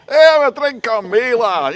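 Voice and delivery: put-on very loud, high drunken holler; happy drunk. Happy drunk offering a stranger a drink, hollering high. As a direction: bellow a boozy offer across the bar. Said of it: "The ones that say".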